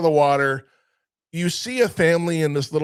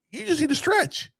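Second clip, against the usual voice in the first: put-on much higher, lighter kid voice; imitated kid; 14 semitones higher than usual. Kid advising an ogre with a bad back, high-pitched imitation.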